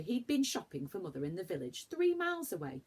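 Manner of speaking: usual speaking voice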